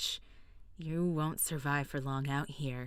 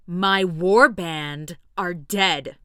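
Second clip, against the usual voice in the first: grief-stricken outburst; 12 dB louder, much slower than usual. Acted. Grieving warrior snaps back.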